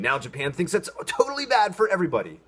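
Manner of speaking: mock quote. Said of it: "taking a label for something and saying".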